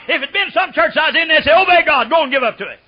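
high, loud shouted preaching; preaching at full force. Acted. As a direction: drive it home to the pews.

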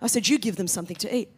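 a touch of God rolling his eyes; mild exasperated God. Retold or imitated. God answering her excuses, softly and fast with exasperation. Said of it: "God's kind of rolling his eyes".

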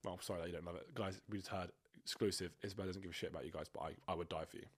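ordinary talk, nothing marked

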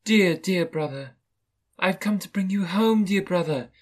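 high-pitched, affectionate little sister; little girl. A narrator's reading. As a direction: greet a beloved brother in a child's voice. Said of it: "said the child".

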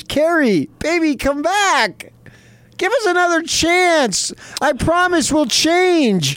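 slow, high, hammed-up begging; mock pleading. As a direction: plead like a jilted lover. Begging listener to return.

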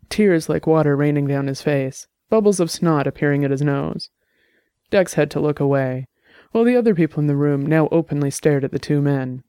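usual speaking voice

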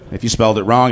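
ordinary talk

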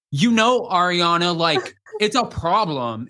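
slow, halting impression of a man; impersonating him. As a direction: drag out a mock-serious imitation. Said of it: "to impersonations".

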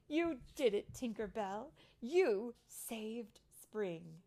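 loud, emphatic storybook queen; slow, stressed royal praise; delighted praise. A narrator's reading. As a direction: congratulate her, drawing out the last words. Fairy queen praises the heroine.

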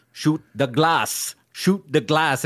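lightly voiced German villain giving an order; imitating Hans Gruber. Quoting the villain's line to friends, lightly imitating the villain. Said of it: "The way he says".

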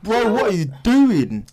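exasperated reaction, stretched out; retold disbelief. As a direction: draw it out in mock disbelief. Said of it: "I was like".

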